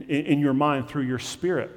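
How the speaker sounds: usual speaking voice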